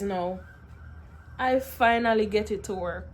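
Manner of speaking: ordinary delivery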